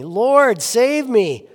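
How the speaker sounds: loud, high plea for rescue, read aloud; crying out. A narrator's reading. Peter calling to Jesus as he sinks, crying out. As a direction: cry out to be saved, raising pitch and volume. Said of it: "he cried out".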